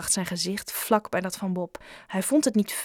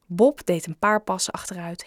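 ordinary delivery, nothing marked

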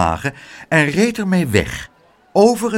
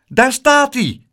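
high, loud call; excited shout; 10 semitones higher, 5 dB louder, slower than usual. A narrator's reading. Boy spotting the lost child, high loud shout. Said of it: "riep Pluk".

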